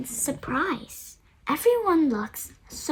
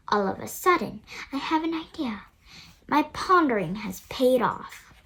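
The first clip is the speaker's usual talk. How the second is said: plain reading voice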